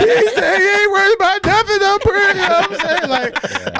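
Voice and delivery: high, loud and breaking with tears; tearful. Real, not acted. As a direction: push through the crying to finish. Crying recounting brother in heaven.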